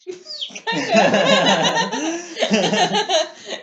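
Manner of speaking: loud attempted whistle; whistling through teeth. Shown as a demonstration. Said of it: "It kinda sounds like something".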